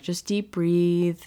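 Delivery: slow, breathy calming voice; slow soothing coax. Retold or imitated. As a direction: ease her down to sleep, slowly. Mom soothing daughter at bedtime.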